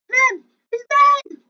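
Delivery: loud high shout of dismay. Real, not acted. Player yelling as a teammate dies, loud shout. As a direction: yell it out as your buddy goes down.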